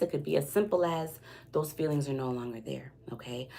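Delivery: soft confiding whisper. Real, not acted. Reader gently telling the viewer the feelings are gone, in a whisper. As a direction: drop into a whisper for the point.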